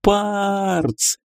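high yell into his ear. A narrator's reading. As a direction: shout his name right in his ear. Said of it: "завопила ему на ухо".

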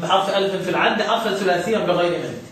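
soft measured recitation of the verse; quiet poem recital; recited verse. Shown as a demonstration. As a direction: recite the line gently, measure by measure.

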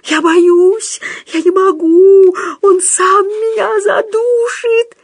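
high, loud panicked wail; terrified yelling. A narrator's reading. Худяков refuses to strangle Пётр, high panicked screaming. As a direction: scream in panic, stretching the words. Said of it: "заорал Худяков".

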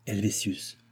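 ordinary delivery, nothing marked

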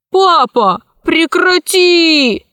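high, drawn-out plea to daddy; demanding child. Acted. Heiress demands her father stop Hera, high child voice. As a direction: stretch it high like a spoilt girl.

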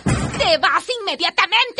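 orden tajante y furiosa; échalo de la casa con rabia; enojada. Acted. La madre echa al tío. (la madre expulsa al tío de la casa, furiosa)

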